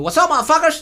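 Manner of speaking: show-off American English accent, high and punchy; comic demo of an American English accent; supposedly perfect English accent. Shown as a demonstration. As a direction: burst out in boastful American English. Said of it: "mi acento es perfecto".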